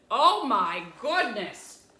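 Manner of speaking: loud, slow astonished exclamation; loud amazement. A narrator's reading. Mister Watson amazed by piglet.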